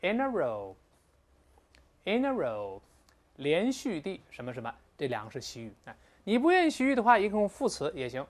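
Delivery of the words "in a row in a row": "in a row" with "in a" run together as ina; linked words. Shown as a demonstration. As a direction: slowly say ina-row, drawing out row. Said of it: "in 呢再连到一块儿".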